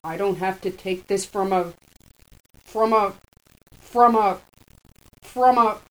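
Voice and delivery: loud, rising sputtering rage; shaking with anger. A narrator's reading.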